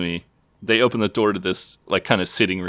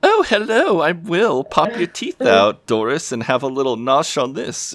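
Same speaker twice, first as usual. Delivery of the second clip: little mocking put-on voice, very high; Will; 8 semitones higher than usual. [name] mocks his friend at the old people's home, little high voice. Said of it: "[name] does this little voice".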